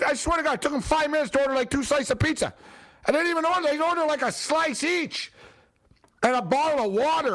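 high-pitched, incredulous complaint; exasperated rant. Real, not acted. Ranting about the slow couple.

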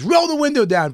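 high, loud barked command; angry cop order. Retold or imitated. Cop at Patrice's car window, high angry order. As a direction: demand it through the window. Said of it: "he goes".

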